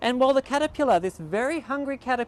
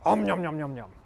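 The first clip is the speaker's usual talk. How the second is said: nom nom chomping; 4 semitones lower than usual. Pretend. Caterpillar eating silks.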